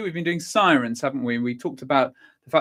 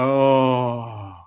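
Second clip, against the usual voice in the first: groan; 4 semitones lower than usual. Shown as a demonstration. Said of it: "pull it all out".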